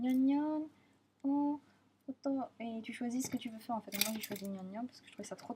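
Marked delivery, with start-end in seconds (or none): 0.0-2.4 imitating cute maid. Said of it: "ils te disent".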